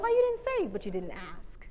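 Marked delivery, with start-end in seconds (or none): 0.0-0.7 much higher, mock-outraged question; indignant question. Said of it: "And it's like".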